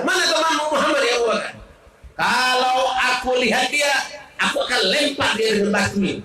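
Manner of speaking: a furious woman calling out for Muhammad; angry demand. Acted. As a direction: confront him, spitting the question. Ummi Jamil threatening with stone.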